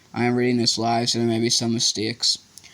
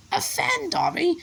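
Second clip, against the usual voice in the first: much higher choked elf, slow; slower than usual. A narrator's reading. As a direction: choke it out through tears. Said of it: "choked the elf".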